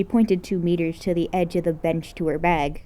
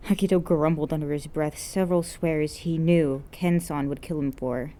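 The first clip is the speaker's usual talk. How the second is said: plain reading voice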